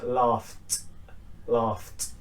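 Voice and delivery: "laughed" ending in f plus voiceless t. Shown as a demonstration. Teacher modelling G H as F, T ending. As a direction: say "laughed" as one syllable, "lahft". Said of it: "is pronounced with a f".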